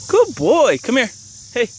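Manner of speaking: high squeaky praise for a dog. Real, not acted.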